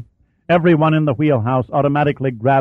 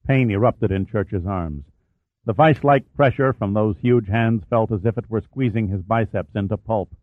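usual speaking voice

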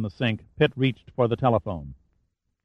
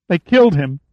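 a sobbing, higher, louder cry; 6 semitones higher, 5 dB louder than usual. A narrator's reading. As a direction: break down crying on the words. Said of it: "she sobbed".

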